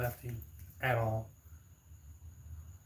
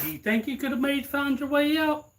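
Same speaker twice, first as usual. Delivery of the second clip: 1 teasing question; 11 semitones higher, 5 dB louder than usual. Retold or imitated. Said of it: "I said".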